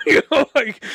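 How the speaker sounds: laughing loudly while talking; real laughter breaking in. Real, not acted. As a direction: laugh through the words. Laughing at memory.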